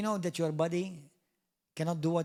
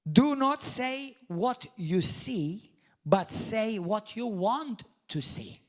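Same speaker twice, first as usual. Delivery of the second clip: slow, louder, higher exhortation; slow emphatic charge; 4 semitones higher, 4 dB louder, much slower than usual. Acted. Preacher urges the congregation to remember, slow and emphatic. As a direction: drive each word home. Preacher stresses key teaching.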